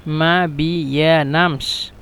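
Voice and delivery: মা বিয়ানামস drawn out syllable by syllable; slow syllable demo. Shown as a demonstration. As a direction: teach মা বিয়ানামস slowly, ma-bi-ya-nams. Teacher breaks phrase into syllables.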